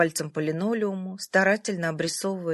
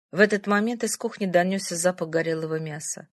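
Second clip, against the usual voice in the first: ordinary delivery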